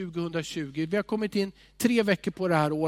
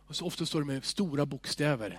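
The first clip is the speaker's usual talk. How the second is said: usual speaking voice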